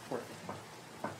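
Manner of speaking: soft, hushed sympathy; tender pity. Real, not acted. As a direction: coo gently over the poor pet. Pitying the hiding cat.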